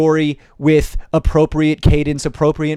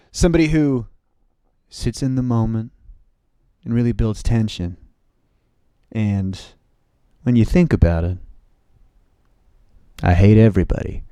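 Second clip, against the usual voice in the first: sit in the silence, voice dropped low; tense, pause-laden deadpan; 6 semitones lower than usual. Shown as a demonstration. Demonstrates a comic's tense slow pauses.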